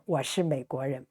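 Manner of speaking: 我是美国人 said fast in one breath; quick natural run. Shown as a demonstration. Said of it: "teacher models at speed".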